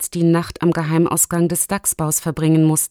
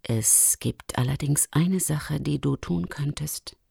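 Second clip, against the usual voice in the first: confiding Luchsin; 4 semitones lower, 8 dB quieter than usual. Acted. Luchsin has a secret idea. (langsam, leise und vertraulich)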